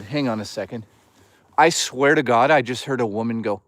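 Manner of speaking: usual speaking voice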